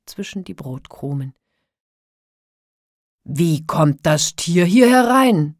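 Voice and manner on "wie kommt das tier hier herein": slow, booming outraged yell; giant shouting. A narrator's reading. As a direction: roar it slowly as an indignant giant. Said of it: "schrie der Riese". (brüllender Riese)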